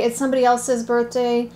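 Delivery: usual speaking voice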